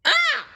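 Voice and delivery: very high loud shriek; cry of fright. Acted. Blinky shrieks falling into the mud puddle. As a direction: squeal as you fall. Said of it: "cried Blinky again".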